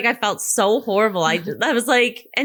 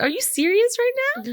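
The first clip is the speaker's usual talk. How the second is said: disbelieving, much higher and slow; incredulous; 12 semitones higher than usual. Retold or imitated. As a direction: ask in total disbelief. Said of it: "I was like".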